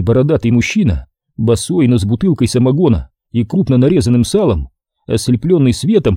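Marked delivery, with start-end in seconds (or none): none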